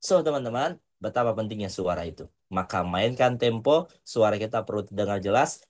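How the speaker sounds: normal talking voice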